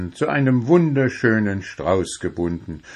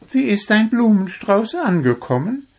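much higher, eager little voice; Zwitschi, curious; 13 semitones higher than usual. A narrator's reading. Zwitschi asks Puh about the flowers, high and curious. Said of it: "fragte Zwitschi neugierig".

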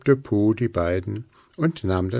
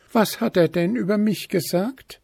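high-pitched, testy elf maiden; snippy; 6 semitones higher than usual. A narrator's reading. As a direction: ask high and with a touch of bite. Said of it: "fragte das Wichtelfräulein bissig".

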